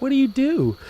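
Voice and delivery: put-on innocent kid. Curious younger self asking.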